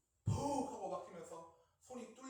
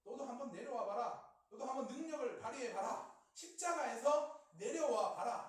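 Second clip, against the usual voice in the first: jeering challenge, building louder and higher; mocking taunt; 3 semitones higher, 7 dB louder than usual. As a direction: taunt him, pushing harder with each dare. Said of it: "예수님을 조롱하는 것입니다". (점점 커지는 조롱조)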